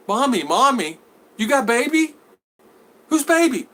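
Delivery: lightly high, baffled repeating; mock echo. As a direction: echo the kid in confusion. Suitor echoing the child.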